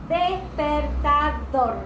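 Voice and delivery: "Despertador" loud and very slow, syllable by syllable to "dor"; stress on final syllable. Shown as a demonstration. Teacher showing the tónica, slow syllabled "Despertador" stressing "dor". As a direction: break up "Despertador" slowly and lean on "dor". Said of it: "la sílaba tónica es dor".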